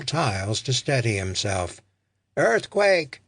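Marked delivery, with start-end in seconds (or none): 2.4-3.2 high, louder warning cry; alarmed shout. A narrator's reading. Someone on the platform warns everyone, shouting. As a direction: call out in alarm, pitched up. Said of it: "someone shouted".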